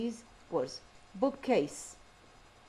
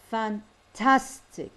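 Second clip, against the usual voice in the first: FanTAStic, the middle syllable punched loud; very slow "Fantastic", hard stress on TAS; word stress demo; 5 dB louder, much slower than usual. Shown as a demonstration. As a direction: break it up and hit TAS in "Fantastic". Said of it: "النبرة تصير قوية عليها".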